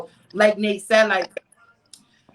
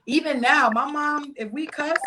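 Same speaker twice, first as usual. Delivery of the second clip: ordinary talk, nothing marked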